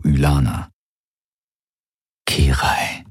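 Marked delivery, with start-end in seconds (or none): 2.2-3.0 unvoiced whisper of a name. A narrator's reading. He checks whether the girl beside him sleeps, whispering. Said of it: "flüsterte er ihr ins spitze Ohr".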